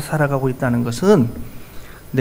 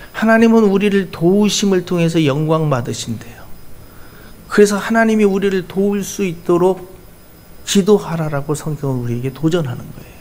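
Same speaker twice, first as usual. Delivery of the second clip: normal talking voice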